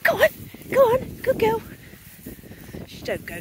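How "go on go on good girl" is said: dog praise. Real, not acted.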